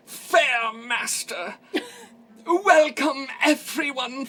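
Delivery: high, loud, drawn-out fairground host; fairmaster accent. Acted. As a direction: welcome the crowd with grand, slow theatrical flourish. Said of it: "this is my accent that I'm gonna do".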